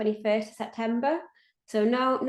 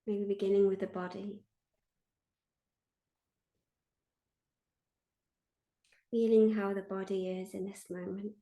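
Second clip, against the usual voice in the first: guided meditation; 3 dB quieter than usual. Acted. Meditation leader invites listeners to feel held, guided meditation. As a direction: lead listeners gently inward, leaving space after each phrase.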